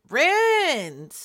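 loud, high, falling "Ruined!"; emphatic exclamation. Real, not acted. Speaker repeating her verdict, now exclaimed loudly.

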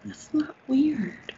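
breathy teasing whisper. Acted. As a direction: whisper it like a secret. Teasing whisper after odd request.

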